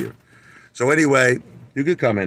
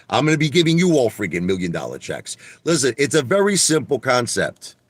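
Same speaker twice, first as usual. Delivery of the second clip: normal talking voice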